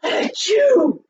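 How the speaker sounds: big sneeze. Acted. The bear's sneeze.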